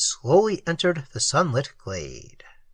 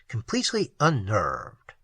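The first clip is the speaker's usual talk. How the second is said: usual speaking voice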